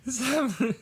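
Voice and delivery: normal talking voice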